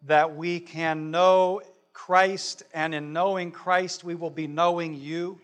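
earnest, measured prayer voice; closing prayer. Real, not acted.

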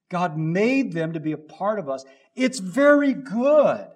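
fervent preaching, slowing at the peaks; preaching at full force. Acted. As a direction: preach it with delight, rising to a peak.

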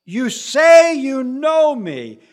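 loud, very slow proclamation; Jesus proclaiming loudly and slowly to debaters. Acted. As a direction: declare it to the whole porch.

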